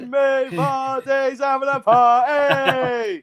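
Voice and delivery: slow, laughing through the words; delighted, laughing slowly. Real, not acted. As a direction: crack up while you shout it out. Greeting Lee amid laughter.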